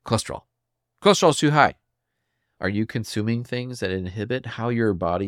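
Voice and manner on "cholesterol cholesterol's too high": higher, louder curt doctor's verdict; doctor dismissing a patient, curt and louder; blunt doctor. Retold or imitated. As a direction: bark it like a busy doctor brushing you off. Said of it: "He just says".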